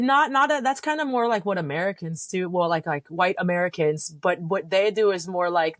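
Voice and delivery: ordinary talk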